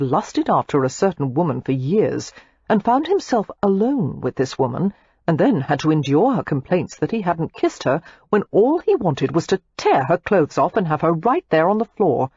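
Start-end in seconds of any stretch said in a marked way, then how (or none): none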